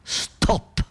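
a single breathy unvoiced "stop"; last whispered plop. Storyteller marking the sparrow's last dropping, whispered. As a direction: whisper it like the final drop. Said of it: "che sembra proprio l'ultimo stop".

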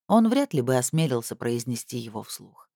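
ordinary delivery

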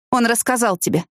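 сухо, чуть повыше обычного; констатируй факт без всплеска чувств; сухая констатация. A narrator's reading. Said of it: "сухо констатировала она". (сухо)

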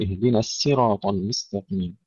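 الصراط as "siraat" with light s; thin the ص of الصراط into س; sin reading. Shown as a demonstration. Teacher showing Qunbul's reading, light s in الصراط. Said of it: "بالسين".

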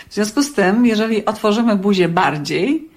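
ordinary talk, nothing marked

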